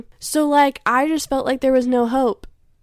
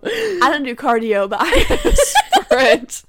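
laughing escape line. Retold or imitated. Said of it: "and I was like".

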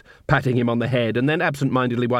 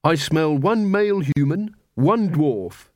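lightly voiced Angua, a touch higher; Angua's voice; 5 semitones higher than usual. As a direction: give Angua a slightly raised, sharper tone.

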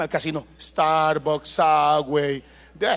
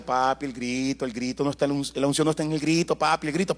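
gentle scolding wife; 6 semitones lower, 7 dB quieter than usual. Retold or imitated. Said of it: "Mi esposa me dice".

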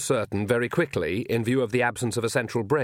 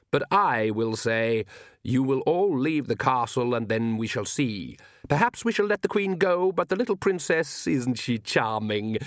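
menacing vampire Count. Acted. Count sets terms for keeping the princess, silkily menacing. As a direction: toy with them as a courteous tyrant.

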